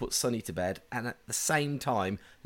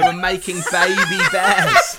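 high, loud, through laughter; laughing delight; 7 semitones higher, 11 dB louder than usual. Real, not acted. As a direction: crow it while cracking up. Joking about mating bears.

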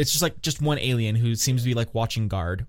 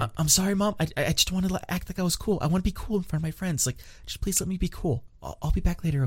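higher, meek apologetic voice; sheepish son; 6 semitones higher, 4 dB quieter than usual. Retold or imitated. Son sheepishly apologizing to his mom. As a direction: plead softly like a sorry kid.

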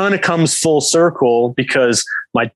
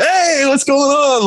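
much higher, slow, bright friendly hello; cheery greeting; 13 semitones higher, slower than usual. Retold or imitated. Player mimics his coach's surprisingly cheerful greeting, much higher. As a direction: play the gruff coach turning suddenly friendly.

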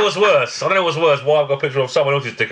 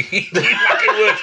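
laughing agreement, higher; 10 semitones higher, 3 dB louder, slower than usual. Real, not acted. Friend cracking up at the joke, laughing. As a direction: laugh the words out.

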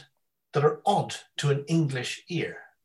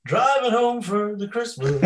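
high, slow, delighted; excited brother; 9 semitones higher, 5 dB louder, slower than usual. Retold or imitated. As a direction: savour the title happily. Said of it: "he always rings me up and he goes".

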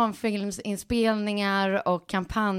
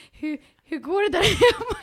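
high, louder quote through laughter; laughing retelling; 9 semitones higher, 6 dB louder than usual. Retold or imitated. Friend on the phone checking on a frazzled mother, laughing. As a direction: imitate the caller while cracking up.

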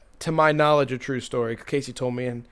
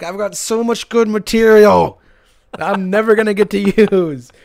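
mock lament; 5 semitones higher, 7 dB louder than usual. Parent with great material and no parties, mock lament. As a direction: wail it in playful frustration. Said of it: "I'm like".

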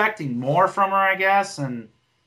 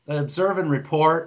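normal talking voice

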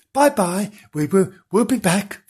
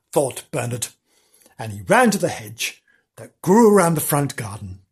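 plain reading voice

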